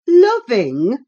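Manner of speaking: loud, high incredulous scorn; scornful. A narrator's reading. Ellen cutting off Cathy's excuse, scornful. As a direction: throw the word back with contempt. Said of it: "as scornfully as I could utter the word".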